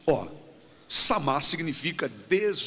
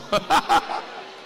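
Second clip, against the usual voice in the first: airy breathy chuckle; a quick laugh at his own joke; short laugh; 7 semitones higher than usual. Real, not acted. Preacher laughing at his joke before the congregation, short chuckle.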